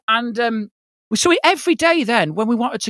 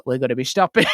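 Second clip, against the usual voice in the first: mock driver announcement; 11 semitones lower, faster than usual. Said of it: "woken with the sound of".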